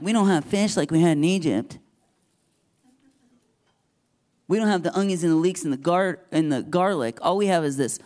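complaining Israelites, whining about missing fish and garlic; whiny complaining crowd. Pretend. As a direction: grumble like spoiled people missing Egypt's food.

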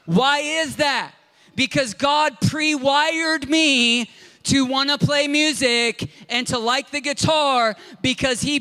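high, loud sermon climax; fiery preaching. Acted. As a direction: preach it out to lift the congregation. Preaching at full force.

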